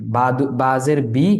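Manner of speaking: slow, careful বা জের বি; teacher spelling slowly; slow demo. Shown as a demonstration. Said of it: "জেরের উচ্চারণও সহজ".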